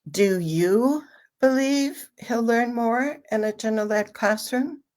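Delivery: YOU stressed and held, then a short break. Shown as a demonstration. Trainer demonstrates asking a parent's question, stress on you. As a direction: hit the word you, asking for their view. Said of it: "if you emphasize the word you".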